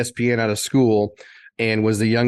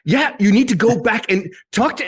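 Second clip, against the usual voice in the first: imitating the producer; 10 semitones higher than usual. Producer urging the young reporter back, high mock voice. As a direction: push it up high like a pressing boss. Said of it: "And he's like".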